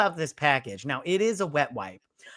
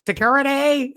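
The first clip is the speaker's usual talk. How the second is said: high, loud mock cry; mock call for security; 9 semitones higher, 5 dB louder than usual. Woman summons security on the drifters, mock-alarmed cry. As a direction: overdramatic call for help.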